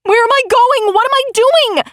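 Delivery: very high panicked cries; screaming. A narrator's reading. Narrator screams while speeding down the road, panicked high voice. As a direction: pitch flies up in terror. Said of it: "I scream".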